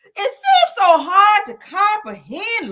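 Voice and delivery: high, loud, slow declaiming; hammed-up anguished recital; overwrought poem cry. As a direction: cry it out to the heavens. Dramatic poetry reading.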